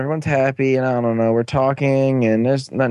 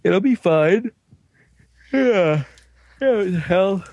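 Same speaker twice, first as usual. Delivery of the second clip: hobo guy; 5 semitones higher than usual. Retold or imitated. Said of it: "hobo guy's like".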